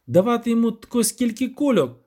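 loud, high indignant scolding; angry. A narrator's reading. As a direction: tear into them with mounting outrage. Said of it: "розсердився Любисток".

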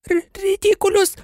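high, stammered squeak of a spell; high-pitched nervous squeak; Neville's squeak. A narrator's reading. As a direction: squeak it out, tripping on the first sound. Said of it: "писнув Невіл".

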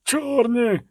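high, alarmed call of warning; frightened cry. A narrator's reading. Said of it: "крикнув провідник, бліднучи".

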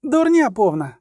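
high, heated denial; indignant. A narrator's reading. Ciri scorns the merchant's claim, high and heated. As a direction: spit out the dismissal.

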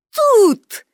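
put-on high, proud girlish exclamation. A narrator's reading. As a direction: announce it proudly in a high child's voice. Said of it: "гордо відповіла Пепі". (гордий вигук)

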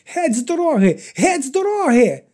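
fighting her way out, shrieking. A narrator's reading. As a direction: scream in a nightmare panic. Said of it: "верещала".

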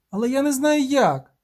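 put-on high-pitched child's protest; little girl. A narrator's reading. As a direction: object in a high girl's voice. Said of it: "захована в листі дівчинка".

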